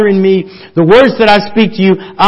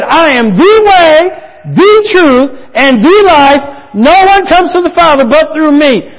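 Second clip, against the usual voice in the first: loud, much higher, ringing out; proclaiming; 8 semitones higher, 5 dB louder than usual. Acted. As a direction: declare it boldly to the whole church. Preacher proclaims Jesus' words.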